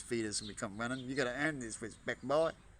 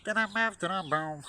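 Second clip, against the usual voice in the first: beatbox sound effect; 8 semitones higher, 9 dB louder than usual. Pretend. Vocal sound effect rhythm.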